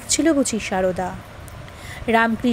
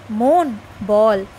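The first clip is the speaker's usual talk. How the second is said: slow inner call; 5 semitones lower, much slower than usual. A narrator's reading. Monk addressing his own mind.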